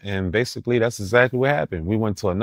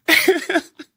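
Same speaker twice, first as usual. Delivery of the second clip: sudden high-pitched burst of laughter. Real, not acted. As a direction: cackle at the memory. Laughs recalling Sarah scene.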